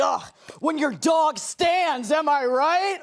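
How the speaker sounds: mock the streamer's disgust at his dog; mock exasperation. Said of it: "It's like".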